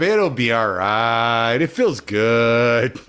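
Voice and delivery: slow, stretched-out carefree reassurance; easygoing inner voice. Acted. Right brain excusing the act, slow carefree coaxing. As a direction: draw the words out, indulgently.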